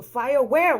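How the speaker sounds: loud, high repeated question; retold urgent demand for the place; insistent demand. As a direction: push the question out forcefully. Asks again, insistent.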